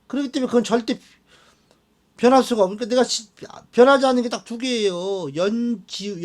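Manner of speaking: normal talking voice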